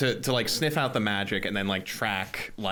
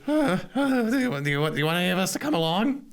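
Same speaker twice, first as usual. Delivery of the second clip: higher, hesitant stammering offer; timid high-pitched character voice; Cheddar's character voice; 9 semitones higher, faster than usual. Acted. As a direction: stumble into the question up high. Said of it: "I think Cheddar goes".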